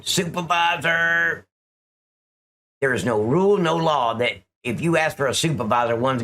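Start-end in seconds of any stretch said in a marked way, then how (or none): 0.0-1.4 slow, drawn-out mocking cry; mock scream. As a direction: stretch it out like her demand. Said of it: "you hear her screaming".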